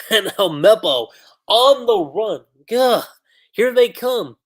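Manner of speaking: laughing and rising high with excitement; excited. Real, not acted. Reacting to Helmeppo charging in, loud and excited. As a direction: get swept up and call it out loud.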